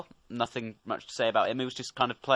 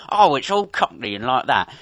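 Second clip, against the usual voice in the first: put-on loud caricature cockney accent; mock cockney; 7 dB louder than usual. Reviewer mocking how Americans see British accents, hammed-up cockney. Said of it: "stereotypical view of the British accent".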